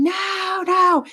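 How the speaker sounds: high, drawn-out wail without volume; high-pitched retold scream; mock scream. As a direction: imitate screaming in free fall, held back. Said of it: "I'm screaming, going".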